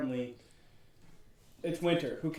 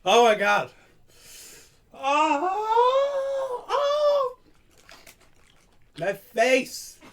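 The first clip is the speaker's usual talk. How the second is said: high pained cry, much louder; mouth burning from spicy food; 9 dB louder, much slower than usual. Real, not acted. Burning mouth from Reaper sauce.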